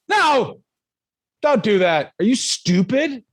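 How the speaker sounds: high, incredulous scolding; mock scolding. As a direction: shoot down a dumb idea. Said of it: "go back to being like".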